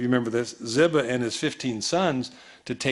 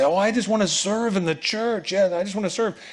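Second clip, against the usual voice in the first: mock eager volunteer; 7 semitones higher than usual. Preacher mimics a church volunteer, mock eager. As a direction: pitch raised high, a churchgoer eager to serve. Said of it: "people say".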